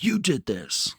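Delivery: high, playful imitation of blame; mock accusation. Imagined player looking up at the owner's suite, mock accusing. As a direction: accuse him in a high put-on voice.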